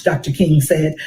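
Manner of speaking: ordinary delivery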